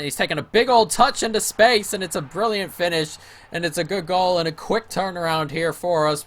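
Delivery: soaring high and loud as the shot flies in; excited goal call. Real, not acted. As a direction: call the winner, thrilled. Goal scored in live commentary.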